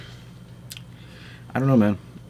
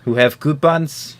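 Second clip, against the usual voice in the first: slow, higher, put-on Russian-like accent; mock accent; 5 semitones higher, slower than usual. Joking back at an offended friend, slow fake Russian-like accent. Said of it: "people who have that accent".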